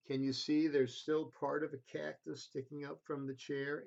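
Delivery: plain reading voice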